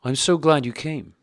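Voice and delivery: a nervous, eager greeting that hides fear; frightened smile. A narrator's reading. Dolly greets Karenin in the sitting room, frightened and smiling. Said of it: "said Dolly with a frightened smile".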